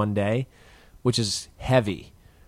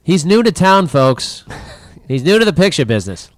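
Hollywood producer voice; 7 semitones higher, 6 dB louder than usual. Pretend. Mock Hollywood producer talk.

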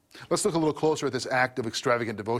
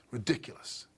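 breathy scoff through a laugh, softer; 7 dB quieter than usual. Retold or imitated. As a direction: laugh the word off in contempt. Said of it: "That's the tone of what's going on here".

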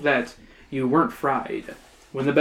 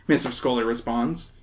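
usual speaking voice

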